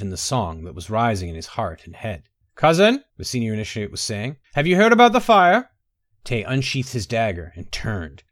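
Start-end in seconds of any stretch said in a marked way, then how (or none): 2.6-3.0 calling out. A narrator's reading. Initiate calls out to Tay. 4.5-5.7 calling out. A narrator's reading. Initiate calls out to Tay.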